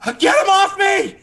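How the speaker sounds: high, loud panicked shrieking; panicked farmer. Acted. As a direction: scream it in terror as beetles swarm. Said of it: "this farmer is like".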